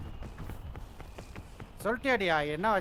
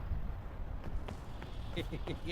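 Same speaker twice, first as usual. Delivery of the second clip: quiet chuckle; 9 dB quieter than usual. Real, not acted. Player amused by teammate's question, quiet chuckle. Player laughs during the game.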